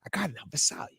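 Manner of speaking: quiet, near-whispered aside; whispered realization. Retold or imitated. Co-host recalling learning the town name, hushed. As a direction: drop to a hush as it clicks. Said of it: "I'm like".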